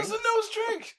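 high, loud mock outrage; retold outraged complaint. Customer griping to friends about the bartender, outraged. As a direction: cry it out in exaggerated disbelief.

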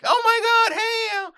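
high, loud mock squeal of delight; over-the-top excited hello; gushing greeting. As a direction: gush like a starstruck celebrity. Gushing celebrity greeting, acted.

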